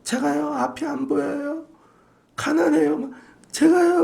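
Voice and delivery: high pleading beggar voice; pitiful whining. Retold or imitated. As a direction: whine to win pity. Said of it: "더 불쌍하게 소리를 내".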